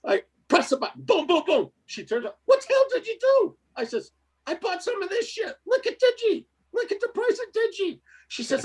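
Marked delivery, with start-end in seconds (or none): 2.5-3.6 wife's voice pitched way up, mock alarm; wife scolding him for trading; high mock wife yelling. 4.5-8.0 pitched high, showing off the gains; giddy, look at Digi; mock excited boast.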